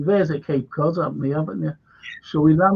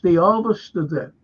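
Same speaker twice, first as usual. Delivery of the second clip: ordinary talk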